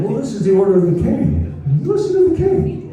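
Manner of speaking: mock royal order in a high voice. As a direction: pitch jumps up, climbing to very high. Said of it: "Did I say that in a higher voice?".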